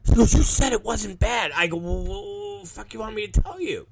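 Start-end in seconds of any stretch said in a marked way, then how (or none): 0.3-1.5 higher, retold complaint without shouting; plaintive accusation. Wrestler being bandaged reproaches the promoter, plaintive accusation. She repeats accusation, weaker. 1.8-3.9 soft, higher-pitched retold excuse; sheepish defence. Promoter answering the bandaged wrestler's accusation, sheepish defence.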